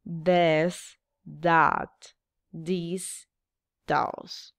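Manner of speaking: "This, that, this, those" said one word at a time, with the last words fading; model again for repeating. Shown as a demonstration. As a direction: class drill of "This", "that", "this", "those". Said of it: "Otra vez".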